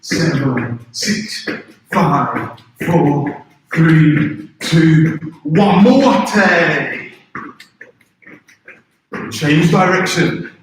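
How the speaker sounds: loud barked count; sharp martial arts commands. Acted. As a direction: count it out like a dojo drill. Karate warm-up count.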